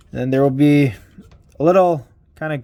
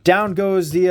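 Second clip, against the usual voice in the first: normal talking voice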